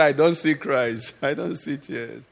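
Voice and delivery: low voice sinking very soft; hushed, low divine reply; quiet, unmoved reply. Acted. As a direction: answer softly as God, unswayed by the pleading. God's unmoved answer to the criers.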